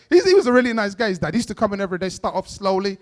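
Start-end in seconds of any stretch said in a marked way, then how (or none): none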